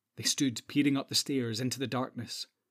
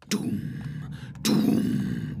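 long, low resonant boom; drumbeat imitation. A narrator's reading. Narrator voices pursuing drums, booming. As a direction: let each drum stroke roll and ring. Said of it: "went the drumbeats again".

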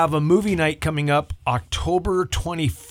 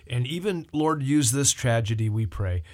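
praying; 4 semitones lower than usual. Acted. Leads a prayer on air.

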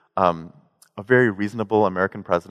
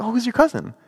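much higher, curious question; 12 semitones higher than usual. Retold or imitated. Asking his friend about the cousin, much higher and curious. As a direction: pitch it up, asking innocently. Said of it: "It's like".

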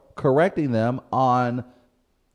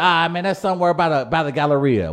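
higher, louder offhand local talk; St. Louis local voice; 7 semitones higher, 6 dB louder than usual. Retold or imitated. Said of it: "we don't actually divide things".

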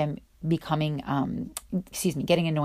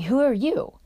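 retold yell; 8 semitones higher, 4 dB louder than usual. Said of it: "He yells across from".

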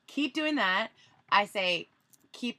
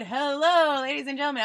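big announcer hello, raised and loud; 7 semitones higher, 9 dB louder than usual. Retold or imitated. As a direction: open like a club DJ. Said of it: "I do the D J voice".

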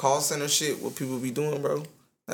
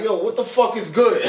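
dad's phone greeting; 7 semitones higher, 4 dB louder than usual. Retold or imitated. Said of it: "He's like, call my uncle".

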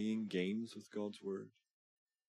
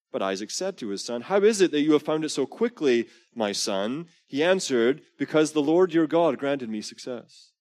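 louder, higher storytelling read of scripture; dramatic scripture reading; 7 semitones higher, 11 dB louder than usual. Acted. Preacher reading Genesis aloud to the congregation, loud and dramatic. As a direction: read the Bible scene out big for the room.

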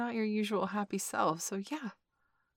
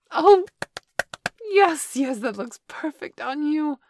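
excited; 9 semitones higher, 11 dB louder, slower than usual. Acted. Delighted at new outfit.

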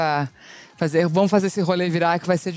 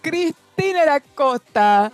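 higher and slower still, echoing; PA announcement; 8 semitones higher, 4 dB louder, much slower than usual. Retold or imitated. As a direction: stretch it out over the loudspeaker. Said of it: "announcement no P A system".

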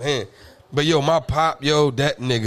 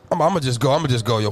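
usual speaking voice

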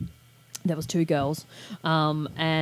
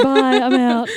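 high, loud, breezy brush-off; mock curt exit; 7 semitones higher, 8 dB louder, slower than usual. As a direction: toss it off like leaving a boring room. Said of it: "You're like".

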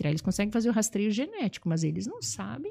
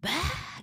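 thin, pitched-up mock scream; mimicked child's scream; 9 semitones higher, 4 dB quieter than usual. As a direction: imitate a toddler's cry, held back. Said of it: "Gritou, gritou, gritou".

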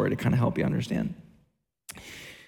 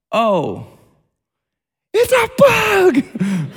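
high, slow, stretched-out scream of panic: it's a bug!; panicked shriek; 14 semitones higher, 8 dB louder, much slower than usual. Retold or imitated. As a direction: freak out loudly, voice shooting up high. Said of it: "Just like that".

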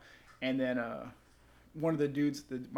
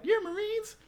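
put-on very high, louder bratty kid voice; mock kid taunt; 14 semitones higher, 6 dB louder than usual. Kids teasing the Marines, squeaky high and loud. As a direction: sneer it like a cheeky ten-year-old.

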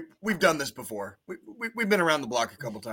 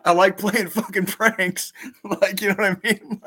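laughing; 7 semitones higher, 4 dB louder than usual. Real, not acted. Imitating the ad guy, laughing.

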